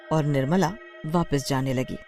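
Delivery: ordinary delivery, nothing marked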